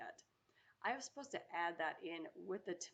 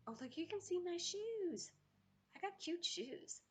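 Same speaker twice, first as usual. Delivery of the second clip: much higher, slow and cutesy; higher slow giggly voice while showing off shoes; high delighted voice; 13 semitones higher, slower than usual. Real, not acted. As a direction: squeal it out, pleased with your cute shoes. Noticing her shoes on camera.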